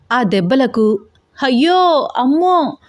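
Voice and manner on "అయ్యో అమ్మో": high, panicked cries of pain; wailing under blows; screaming in pain. A narrator's reading. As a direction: yelp as the belt lands. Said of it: "అని అరుస్తూ గావు కేకలు పెడుతూ".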